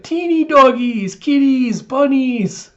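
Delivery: high, loud, drawn-out squeal; excited little girl. Retold or imitated. Little girl thrilled by doggies, kitties and bunnies, excited squeal. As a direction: squeal with delight at each animal. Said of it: "Anya just doing little sparkle eyes".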